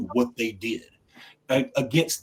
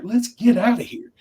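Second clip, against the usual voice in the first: higher, louder hurry-up; impatient urging; 10 semitones higher, 5 dB louder than usual. Retold or imitated. Husband hurrying wife out, impatient higher call. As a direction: push everyone out. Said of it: "And I'm like".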